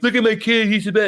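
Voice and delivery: high, mock-gushing parent; bragging parent. Mocking parents who boast about their kids, high and gushing. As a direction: imitate a parent showing off a child.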